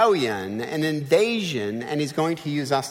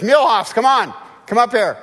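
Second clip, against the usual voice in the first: loud, raised-pitch summons; calling out; 5 semitones higher, 7 dB louder than usual. Acted. God summoning his smallest battle formation, calling out. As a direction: call the troops up to the front.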